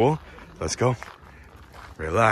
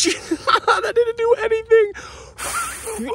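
laughing; much slower than usual. Real, not acted. Failed dandelion blow.

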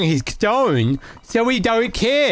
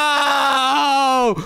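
shocked shout; 6 semitones higher than usual. Real, not acted. Player fired in the game, dismayed shout. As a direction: yell it as the bad news lands.

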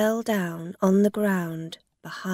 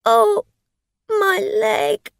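high, loud tearful wail; mock tearful moan for sympathy; crying; 14 semitones higher, 9 dB louder, slower than usual. A narrator's reading. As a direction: whimper it in pain. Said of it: "She began to cry".

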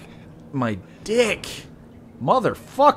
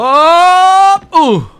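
surprised yelp; 11 dB louder than usual. Real, not acted. Surprised by successful jump.

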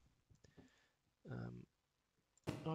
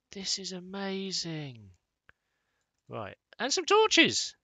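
delighted; 5 semitones higher, 9 dB louder, slower than usual. Real, not acted. Delighted by chest loot.

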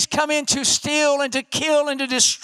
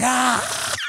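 strained amped-up "ah"; pumped-up holler. Acted. Pastor losing his calm after ten seconds, pumped-up yell. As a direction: burst out like a coach. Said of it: "I'm kinda like that high school coach".

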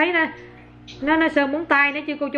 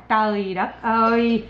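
amazed drawl; 3 semitones lower, much slower than usual. Real, not acted. Amazed at toddler's painting.

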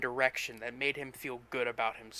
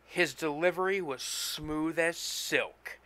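ordinary delivery

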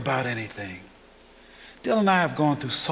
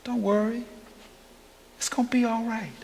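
softer, higher, gentle comfort; tender reassurance; 8 semitones higher, 3 dB quieter than usual. Acted. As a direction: ease their fears softly. Preacher comforting congregation.

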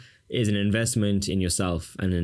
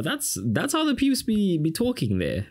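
put-on Durban Indian accent; 11 semitones higher than usual. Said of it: "we like to speak like this".

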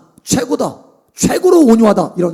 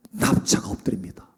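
hushed; 13 semitones lower, 11 dB quieter than usual. A narrator's reading. Telling Moses lying prostrate.

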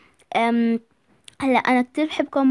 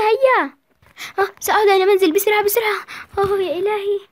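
put-on high girlish voice, hurrying home; a flustered young girl rushing off; daughter role; 8 semitones higher, 5 dB louder than usual. Daughter realising she's late, high and hurried. Girl's part in skit.

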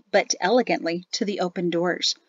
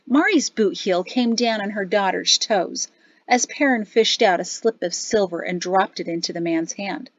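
usual speaking voice

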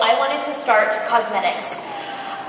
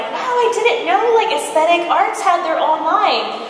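high, drawn-out wow of discovery; slow, high-pitched amazed client; impressed surprise; 7 semitones higher, slower than usual. Acted. Spa client marveling at the line after a facial, pleasantly surprised. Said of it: "you're like".

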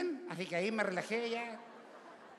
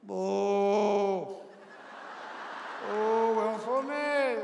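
booing; 2 semitones higher, much slower than usual. Retold or imitated. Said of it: "dije yo".

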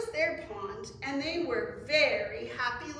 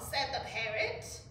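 plain reading voice

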